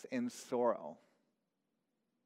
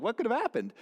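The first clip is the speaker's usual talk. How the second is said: higher and louder teasing question; dad cracking a joke aloud; loud mock wonder; 8 semitones higher, 5 dB louder, faster than usual. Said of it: "he says this out loud".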